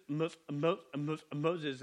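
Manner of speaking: put-on stuttering on Moses; mock stutter. Shown as a demonstration. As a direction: stammer the name's first syllable.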